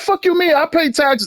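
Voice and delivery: high-pitched mock outrage; mock defiant homeowner.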